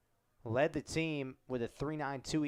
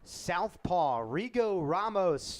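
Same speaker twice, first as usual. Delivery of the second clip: raised, louder announcer build-up to the name; big pitcher introduction; 5 semitones higher, 4 dB louder than usual. Real, not acted. Play-by-play caller announcing the new pitcher to listeners, raised and louder. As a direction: sell the new arrival to the crowd.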